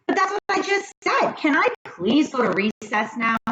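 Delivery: put-on high, eager kid voice; pleading little boy, fast and high; Joe's voice. A narrator's reading. Joe begs the teacher to let him go to recess, high eager kid voice.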